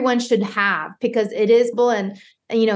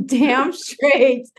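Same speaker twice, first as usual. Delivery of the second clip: laughing. Real, not acted.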